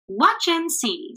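high, slow and gleeful. A narrator's reading. Traveler promising the round man a show, gleeful. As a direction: tease them with delight. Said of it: "with glee".

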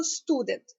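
студент with a strong сту; stress on сту. Shown as a demonstration. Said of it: "ставимо наголос на сту". (наголос на перший склад: студент)

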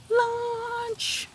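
high sung-out call to come in; sing-song lunch call. A narrator's reading. Teacher calling chalks in.

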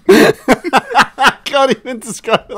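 real laughter, high and slow, barely able to talk; laughing too hard to get the words out. Real, not acted. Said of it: "I'm laughing".

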